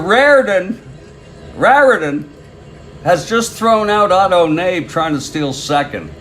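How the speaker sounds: high, loud announcer's call; excited play-by-play. Real, not acted. Announcer reporting a runner thrown out, excited.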